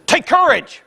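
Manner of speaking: high, sharp shouted order; emphatic command. Acted. Jesus to the frightened disciples in the boat, emphatic shout. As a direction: cry it out as a command. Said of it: "he looks at him and says".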